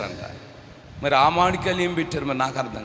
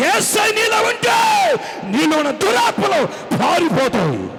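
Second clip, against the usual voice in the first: rising from slow proclamation to high shouting; impassioned, high-pitched pulpit cry; preaching at full force; 7 semitones higher, 6 dB louder than usual. Real, not acted. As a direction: rouse the congregation with triumphant declarations. Preaches to congregation.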